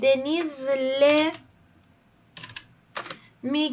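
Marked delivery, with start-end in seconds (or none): 0.0-1.3 drawn-out word. Shown as a demonstration. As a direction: stretch Deniz'le out very slowly, rising at the end. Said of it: "word drawn out for class". (کشیده و آهسته گفتن Deniz'le)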